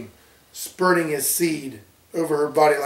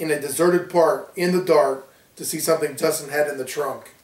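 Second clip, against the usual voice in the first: ordinary delivery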